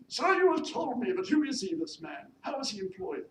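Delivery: loud, high, commanding lady employer; imperious mistress. Acted. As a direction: summon the maid with haughty authority. Mistress interrogates maid.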